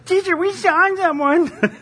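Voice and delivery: high, slow whiny tattletale; whiny little boy. Retold or imitated. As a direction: whine it like a tattling kid. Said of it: "he sounds like a whiny little b".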